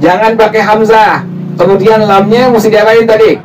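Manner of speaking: normal talking voice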